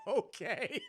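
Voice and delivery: laughing. Retold or imitated. Peter's meek reply.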